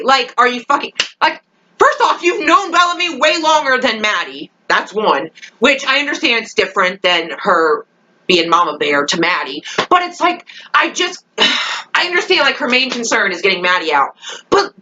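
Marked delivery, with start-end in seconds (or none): none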